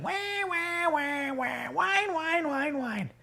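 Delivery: high, thin, soft siren-like "wah"; mocking whine. Storyteller mimics the complaining women for children, mocking whine. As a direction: imitate whining in a high, drawn-out voice. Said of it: "They were whining".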